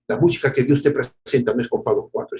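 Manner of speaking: normal talking voice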